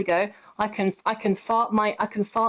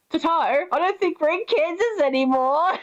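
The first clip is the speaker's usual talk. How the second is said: high-pitched mock Dorothy, breaking into laughter; amused Dorothy parody; 7 semitones higher than usual. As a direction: quote her high and giggly. Repeats parody Dorothy line laughing.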